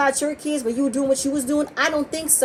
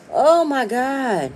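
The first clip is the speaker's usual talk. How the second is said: exasperated; 3 semitones higher, 3 dB louder, much slower than usual. Real, not acted. Fed up with Martell's claims.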